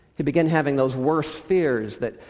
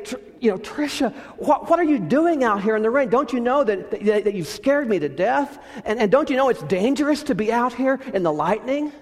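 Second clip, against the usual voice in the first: worried scolding father; 8 semitones higher than usual. Acted. Worried father scolds daughter in rain.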